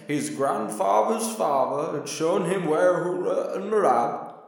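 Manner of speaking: put-on high, slow, quavering old man voice. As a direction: totter through it like an ancient elder. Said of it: "a very old man came tottering up".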